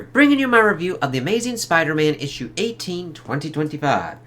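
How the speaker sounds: high, loud showman host voice; announcer intro. Acted. Host greeting viewers in a high, loud showman voice. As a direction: hype up the review opening like a showman.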